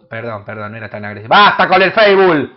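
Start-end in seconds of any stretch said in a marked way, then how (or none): 1.3-2.5 exasperated shout. Real, not acted. Exasperated at chat.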